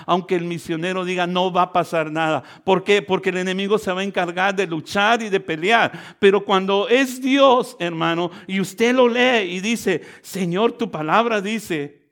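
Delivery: sermon voice rising in force; insistent preacher, hitting key words hard; building preaching. Real, not acted. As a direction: build to a loud declaration, stretching the key phrases.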